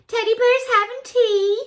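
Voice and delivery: high, cutesy squeal; cutesy delight. Real, not acted. Showing viewers teddy bears having tea, cutesy delight. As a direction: coo it high, delighted by the cuteness. Repeats it, cooing at the card.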